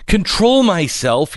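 high, slow incredulous outburst; acted yell, pitch jumping up; indignant yell. A narrator's reading. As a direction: throw it back as an outraged child. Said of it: "yelled Fern".

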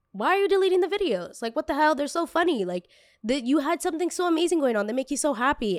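high-pitched, disbelieving protest; imitated incredulous parents. Parents to child deleting the videos, mock disbelief in high voice.

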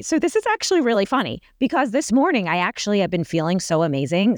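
usual speaking voice

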